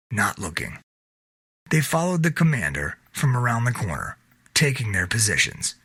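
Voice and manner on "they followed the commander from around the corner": ordinary delivery, nothing marked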